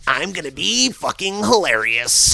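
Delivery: high, drawn-out self-delighted boast; gleeful boast. Retold or imitated. Acts own nine-year-old glee.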